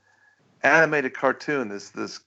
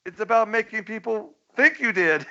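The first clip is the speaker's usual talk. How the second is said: cartoon Pollard voice; 10 semitones higher than usual. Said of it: "he goes".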